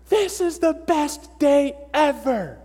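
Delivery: high, drawn-out shout at full lungs; kid's gleeful scream. Retold or imitated. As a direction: scream it like an overjoyed kid, slow and loud. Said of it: "he screams".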